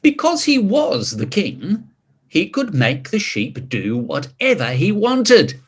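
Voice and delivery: put-on lively read-aloud voice for children; storybook narration. A narrator's reading. Reading King Pig's story aloud to children, lively narration. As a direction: tell it like a bedtime picture book.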